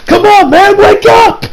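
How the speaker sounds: high, loud acted cry to rouse a friend; desperate shout. Josuke starts shaking Okuyasu to wake him, desperate shout. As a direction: yell it, imitating a frantic voice actor.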